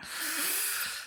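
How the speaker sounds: soft, high chuckle; quiet little high-pitched laugh. Real, not acted. Laughs after his own answer.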